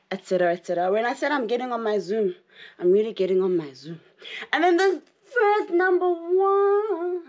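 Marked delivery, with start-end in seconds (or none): 4.5-7.2 slow, high, drawn-out announcement; dramatic build-up. Pretend. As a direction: build suspense slowly.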